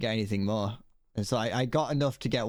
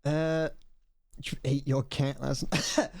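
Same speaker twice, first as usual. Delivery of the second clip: ordinary talk, nothing marked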